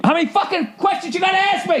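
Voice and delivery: strangled high-pitched furious yell; angry muted rant. Retold or imitated. Caller venting at the robot on mute, angrily. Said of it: "yeah, on mute".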